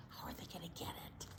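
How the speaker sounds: very soft breathy whisper. Acted. Librarian whispering suspense to the children. Tense moment, reader aside.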